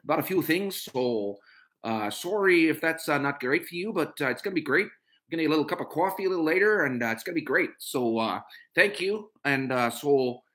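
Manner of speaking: put-on Canadian accent, lifted and chatty. Shown as a demonstration. Performing for online viewers on request, Canadian accent. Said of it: "do my Canadian accent".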